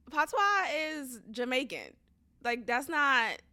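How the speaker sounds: ordinary talk, nothing marked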